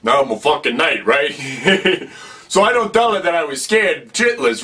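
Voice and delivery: usual speaking voice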